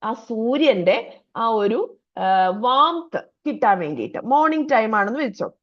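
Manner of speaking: ordinary talk, nothing marked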